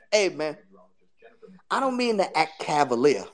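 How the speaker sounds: ordinary talk, nothing marked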